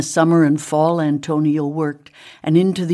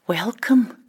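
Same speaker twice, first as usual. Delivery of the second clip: higher, shy little welcome; a touch of blushing shyness; 6 semitones higher than usual. A narrator's reading. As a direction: greet them bashfully. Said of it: "blushing with shyness".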